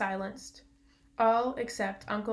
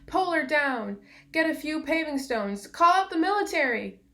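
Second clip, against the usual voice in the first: raised, louder shouts of an angry mob; angry roar; 6 semitones higher, 6 dB louder than usual. A narrator's reading. Angry crowd calling to pull the witch down, roaring. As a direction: shout it higher, building to the last order. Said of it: "an angry roar from the crowd".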